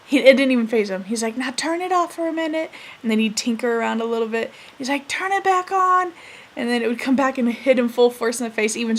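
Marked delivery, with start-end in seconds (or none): 1.4-2.7 put-on higher, clipped order from an old man; bossy old grandpa. Grandpa orders granddaughter at sprinkler, bossy command. 5.1-6.1 put-on higher, clipped order from an old man; bossy old grandpa. Grandpa orders granddaughter at sprinkler, bossy command.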